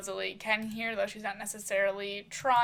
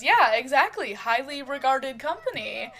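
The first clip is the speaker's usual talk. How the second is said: louder, higher mock-earnest agreement; playful sarcasm; 4 semitones higher, 7 dB louder than usual. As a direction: play along teasingly with emphasis. Bantering, joking sarcastic agreement.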